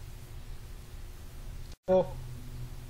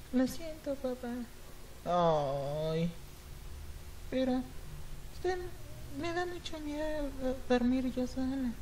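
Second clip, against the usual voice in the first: put-on high, soft, timid child calling her father; small scared girl knocking at her father's door; little girl voice; 4 semitones higher, 10 dB quieter than usual. Girl softly calling her father through the locked door.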